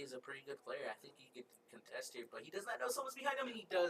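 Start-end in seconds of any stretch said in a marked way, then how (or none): 2.3-3.9 rising fast and high as the flank hits; caster's voice jumping up in excitement; excited play-by-play. Real, not acted. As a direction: call it quicker and higher as the play unfolds. Caster reacts to a surprise flank.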